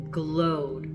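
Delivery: usual speaking voice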